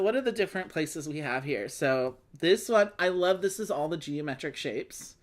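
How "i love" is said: ordinary talk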